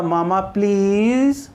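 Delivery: Mama please with please stretched long and gliding upward; pleading rising tone. Shown as a demonstration. Child requesting something from mother, long rising plea. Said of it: "कोई बच्चा अपनी माँ से request कर रहा है".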